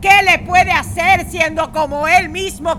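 loud, high theatrical defiance; hammy stage actor. Acted. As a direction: defy fate like a stage Hamlet. Defiant Hamlet speech to sister.

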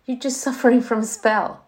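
chuckling through the words; amused, laughing off the spell; queen laughing at the child's excuse. Acted. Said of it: "she said, laughing".

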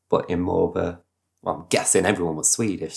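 ordinary delivery

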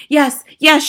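high, loud insistence; shouting. A narrator's reading. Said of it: "shouted the first witch".

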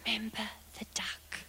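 unvoiced whisper under the breath. A narrator's reading. Sister whispering a threat to her brother, hushed whisper. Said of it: "she whispers under her breath".

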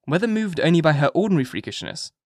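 ordinary delivery, nothing marked

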